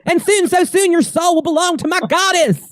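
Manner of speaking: high, melodramatic villain threat; possessed servant. Acted. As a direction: declaim it as the goddess's fanatical slave. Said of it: "she becomes the same".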